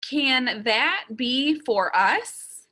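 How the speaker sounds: slow, evenly spaced words, rising end; model for repeating. Shown as a demonstration. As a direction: say it slowly for learners to repeat. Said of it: "Repeat after me".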